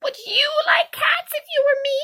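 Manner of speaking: a tiny creature offended to the core; indignant mouse squeaking at Alice, outraged; shrill, passionate mouse. A narrator's reading. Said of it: "in a shrill, passionate voice".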